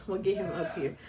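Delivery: ordinary talk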